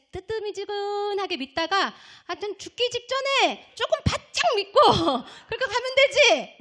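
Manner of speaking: high, loud mock-casual voice with laughter; imitated complacent believer. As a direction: make light of it, mimicking a lukewarm churchgoer. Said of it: "어떤 사람 이런 얘기해요".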